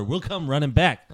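ordinary talk, nothing marked

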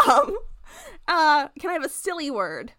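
ordinary delivery

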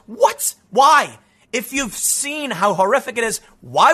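sharp high startled What, then Why; incredulous outburst at the tweet; disbelief. Real, not acted. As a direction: react in stunned disbelief. Reacting to shocking tweet.

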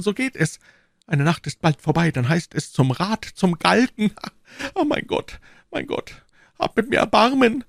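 high, anguished lament; despairing prisoner. Acted. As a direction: bemoan your fate in a high voice. Voices Bonacieux lamenting to himself.

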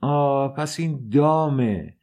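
high, drawn-out outcry; slow, high cry of alarm; horrified cry. A narrator's reading. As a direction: cry out as the trap dawns on you. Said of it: "فریاد زد".

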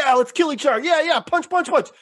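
high, hammed-up gung-ho chant; mock eager fighters. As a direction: mimic dumb brawlers cheering each other on. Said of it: "So everyone's like".

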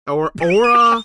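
high, loud, drawn-out announcement of the name; oddly dramatic name reveal. Real, not acted. Podcaster introducing Aura to co-hosts, with oddly exaggerated emphasis. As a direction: belt out the name, stretched and high. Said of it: "Why'd you say it like that?".

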